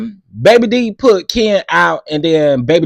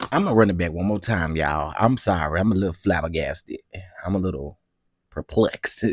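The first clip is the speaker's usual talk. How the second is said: much lower and softer, dry; deadpan flabbergasted; 11 semitones lower, 9 dB quieter than usual. Real, not acted. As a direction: play it dead flat and stunned. Deadpan after the shock.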